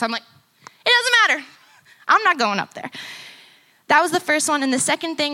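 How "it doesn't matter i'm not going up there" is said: high, loud flat refusal; won't go on stage, and says so loudly; panicked refusal. Retold or imitated. To her bandmate Sean, a loud panicked refusal. Refusing to play the booked show.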